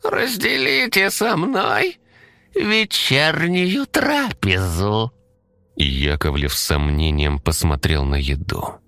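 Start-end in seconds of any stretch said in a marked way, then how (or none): 0.0-5.1 very high, slow sugary squeak; pleased squeaky monster. Acted. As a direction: invite them in with a sweet squeak. Monster voice continues.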